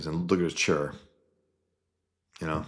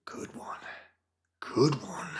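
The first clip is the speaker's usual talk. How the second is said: breathless whisper, dry sarcasm; mock whispered jab; whispered retort; 3 semitones higher, 5 dB quieter than usual. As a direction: hiss it under your breath. Sarcastic retort, then starts laughing.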